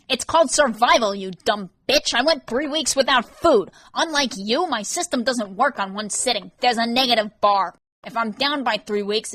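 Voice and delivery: high, loud bitter rant; a bitter man ranting at Rain; Vance ranting. Acted. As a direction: pour out the grievance at her.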